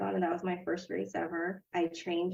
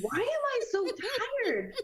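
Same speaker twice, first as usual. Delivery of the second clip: much higher, mock-bewildered; baffled self-quote. Puzzling over her exhaustion on the hike, high and bewildered. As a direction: throw your voice way up in disbelief. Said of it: "So I was like".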